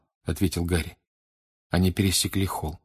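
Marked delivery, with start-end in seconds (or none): none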